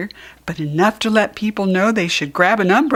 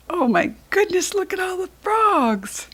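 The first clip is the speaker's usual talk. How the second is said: amazed delight; 11 semitones higher than usual. Acted. Onlooker seeing the new pond fill with frogs, high amazed voice. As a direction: marvel at all the frogs.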